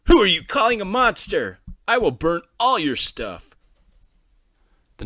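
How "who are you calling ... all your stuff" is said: loud mocking older-brother voice; taunting bully. A narrator's reading. Big brother taunting little brother while burning toys. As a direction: sneer and threaten him.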